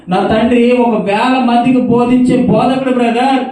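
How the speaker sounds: high, loud anguished cry; weeping caller. Retold or imitated. Daughter of a preacher lamenting to the brother, loud anguished cry. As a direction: cry out in shame.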